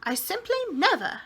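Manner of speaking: scandalised gasp of a prim landlady; shocked landlady. A narrator's reading. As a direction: Miss Persimmon aghast at guests in the air. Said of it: "she said".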